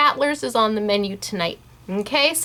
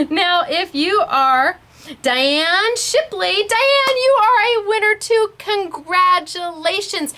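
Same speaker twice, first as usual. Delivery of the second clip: higher, slow and drawn out, half laughing; excited announcement; 8 semitones higher, slower than usual. Real, not acted. Host congratulating a viewer by name, slow and delighted. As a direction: call out the winner's name with delight.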